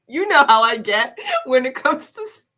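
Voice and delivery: breaking up into laughter mid-sentence; real laughing. Real, not acted. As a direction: laugh through the words until they give out. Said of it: "why are you laughing?".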